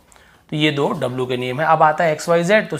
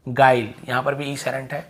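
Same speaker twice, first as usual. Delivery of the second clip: normal talking voice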